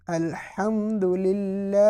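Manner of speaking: very slow الحمدللہ with a light hold on the د; stay on dal. Shown as a demonstration. Teacher demonstrating the stay on dal. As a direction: pause gently on the dal of الحمدللہ. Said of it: "دال کے اوپر بھی stay کیا".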